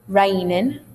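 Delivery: RAINING as "rainin'"; RAINING without its final g; dropped ng. Shown as a demonstration. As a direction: end RAINING on a plain n. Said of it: "I took off the ng".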